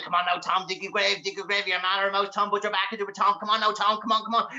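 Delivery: high, nagging demon chivvying; awful excited. Acted. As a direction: urge him on in an excited imp voice. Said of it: "awful excited".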